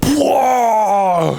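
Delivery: high, drawn-out whoa of amazement; amazed whoa. Real, not acted. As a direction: cry out, totally impressed. Stunned reaction to kid's answer.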